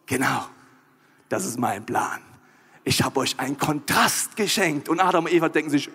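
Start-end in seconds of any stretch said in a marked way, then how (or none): 0.0-4.9 low, measured God voice; serene, deep-voiced assurance; calm reassurance. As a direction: reveal the plan deep and unhurried. God calmly reveals his plan.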